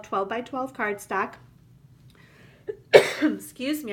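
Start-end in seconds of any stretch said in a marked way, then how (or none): 2.7-3.2 short dry throat-clearing cough. Real, not acted. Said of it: "a little tickle in my throat".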